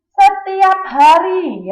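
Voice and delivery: Setiap hari drawn out slowly, stress on setiap; slow, clear answer. Shown as a demonstration. Teacher reads answer slowly to her class. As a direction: spell out the answer slowly for pupils.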